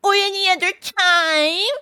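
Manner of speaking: high, slow put-on retort; cheeky Mole. A narrator's reading. Mole answering the sentry, cheeky. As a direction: sass back in a high voice.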